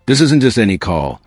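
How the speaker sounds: normal talking voice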